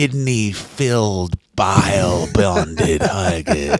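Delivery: low, slow gloating voice with a laugh; grisly mock server. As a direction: savour each gory word lowly. Mock Scottish server, relishing gore.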